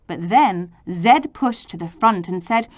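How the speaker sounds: ordinary delivery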